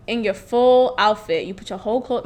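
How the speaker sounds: low, quiet, unbothered man; mad calm. Retold or imitated. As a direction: imitate him staying very calm, softly. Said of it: "he's mad calm".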